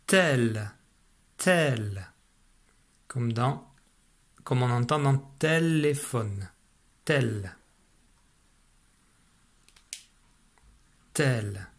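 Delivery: « tel » articulé posément, répété comme une dictée; dictée de syllabe. Shown as a demonstration. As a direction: faire entendre « tel » à l'élève, bien détaché. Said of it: "écoute bien". (syllabe « tel » dictée lentement et clairement)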